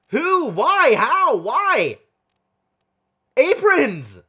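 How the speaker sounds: high, loud one-word cries of disbelief; frustrated outburst. Real, not acted. Stumped player ranting about the apron-wearing sailor, frustrated cries. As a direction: hurl each question at the ceiling.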